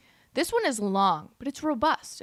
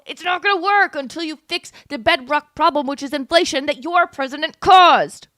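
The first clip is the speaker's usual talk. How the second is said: loud, higher, furious; angry rant; 4 semitones higher, 7 dB louder than usual. Real, not acted. Streamer blaming inflation on the president, angry and loud. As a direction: hammer the point home in anger. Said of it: "it's infuriating".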